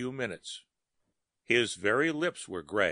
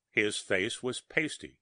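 ordinary delivery

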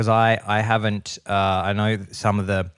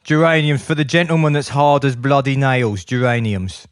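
higher, mock-tough Cockney sales pitch; Cockney accent; 3 semitones higher than usual. Cockney hard man hawking geraniums, Cockney accent. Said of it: "of Cockney men".

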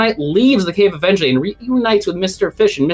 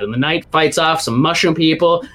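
ordinary delivery

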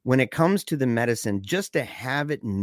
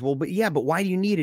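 fast, higher mock badgering; retold pressing question; 6 semitones higher, faster than usual. Andy badgering Michael during the safe fight, fast mock impatience. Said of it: "they're yelling at each other".

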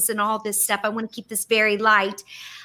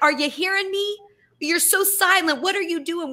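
pleading with God; 6 semitones higher than usual. Retold or imitated. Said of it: "we might think that God is like".

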